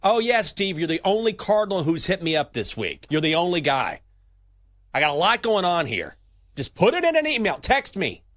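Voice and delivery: high, loud exasperated brush-off; irritated boss on the phone. Pretend. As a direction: tell a caller to just email. Pope on phone with Cardinal.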